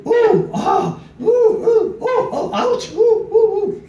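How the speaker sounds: high, loud ooh and ah moaning; hammed-up pained oohs and ahs; mock moans of pain. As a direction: moan up high like a nervous first-timer. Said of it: "again she goes".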